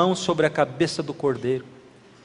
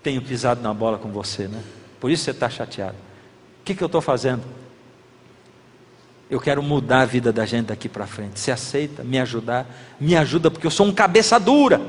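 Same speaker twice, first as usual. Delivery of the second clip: humble apology; 7 semitones lower, 4 dB quieter than usual. Retold or imitated. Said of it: "E eu digo assim".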